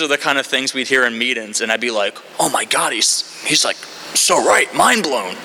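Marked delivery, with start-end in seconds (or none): 2.4-5.3 mock awe. Acting his awed younger self.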